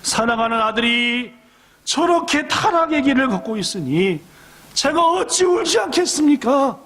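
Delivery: rising to a high crying shout; mother in a dream cries out over her son; wailing cry. Acted. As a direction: plead and wail, climbing to a cry. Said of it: "막 울부짖으며 얘기했대요".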